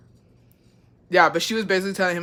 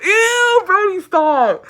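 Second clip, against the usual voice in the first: very high, loud squeal, dragged out; grossed-out scolding; 13 semitones higher, 7 dB louder, much slower than usual. Real, not acted. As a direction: shriek at Brody to stop, disgusted. Breaks off to scold Brody.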